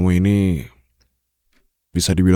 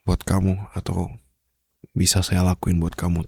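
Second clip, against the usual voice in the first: ordinary talk, nothing marked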